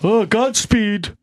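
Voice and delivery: mock Earl's farewell. Host mocking Earl saying goodbye to a dead musician, mock-mournful. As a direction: imitate a drawn-out solemn farewell. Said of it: "He announces dead p".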